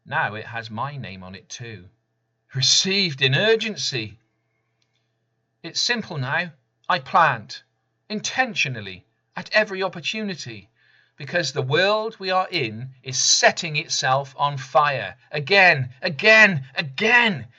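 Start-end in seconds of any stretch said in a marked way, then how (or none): 2.5-15.2 higher, louder, quickening call; spoken-word crescendo, higher and louder; rising urgency. Acted. Poem builds to rousing climax. 15.3-17.4 each "again" higher and louder than the last; escalating cry of "again"; building repetition. Acted. Repeated word climbing in climax.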